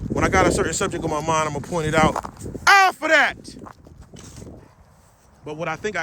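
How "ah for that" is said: sudden loud exclamation. Real, not acted. Sudden outburst.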